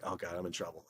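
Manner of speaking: a sinking 'uh-oh' to himself; worried aside. Retold or imitated. Bracing for a powerful caller's reprimand, hushed dread. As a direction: drop to a hushed, dreading mutter under the breath.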